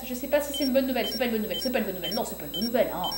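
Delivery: low, soft repeated mumble; uneasy muttering. Real, not acted. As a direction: mutter doubts to yourself.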